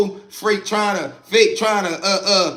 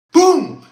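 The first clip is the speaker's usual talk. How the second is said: sharp high shouted boom, like a fist on a cell door; loud bang imitation; 11 semitones higher, 9 dB louder than usual. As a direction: slam the word out as a door bang. Door-banging in cell block.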